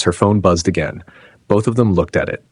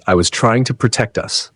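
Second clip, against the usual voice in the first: ordinary delivery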